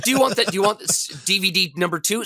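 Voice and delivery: high, hyped-up pitchman; mock sales pitch. Pitching a second DVD by mail, high mock sales voice. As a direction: pitch it through a laugh, high.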